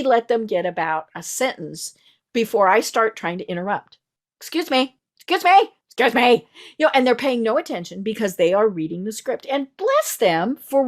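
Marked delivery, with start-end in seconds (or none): 4.4-6.5 rising, louder repeated excuse me; insistent interrupting. Retold or imitated. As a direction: cut in, getting more insistent. Interrupting a scripted telemarketer.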